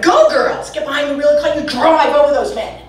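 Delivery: high-pitched, mock rallying cry; lightly hammed-up cheerleading; cheering on. Comic urging the women to drive, cheering them on.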